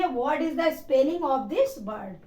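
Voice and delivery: very slow, careful dictation pace; teacher models the formal question slowly; slow model sentence. Shown as a demonstration. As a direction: spell it out slowly for the class. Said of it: "तो उसको बोलेंगे".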